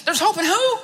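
baffled, asking again; a panicked stranger asking who could bring hope; incredulous stranger. Retold or imitated. Stranger questions, retold dream.

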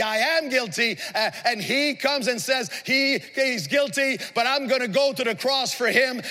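high, ringing sermon delivery, driving phrase after phrase; impassioned preaching. Real, not acted. Preacher declaring forgiveness of guilt to the congregation, impassioned. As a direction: proclaim it to the whole congregation with force.

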